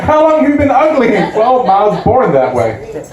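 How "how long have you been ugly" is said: higher mock-shocked mom voice; mom's disbelief. Mom reacting to [name] coming out as ugly, mock-shocked. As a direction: play the stunned mother. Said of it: "My mom couldn't believe it".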